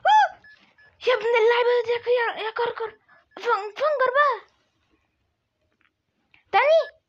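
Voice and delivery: high, loud whimpering complaint, half-joking; crying whine. Real, not acted. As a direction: wail like a sore loser near tears. Gamer wails over losing in game. (نواح باكٍ نصف مازح بصوت عالٍ)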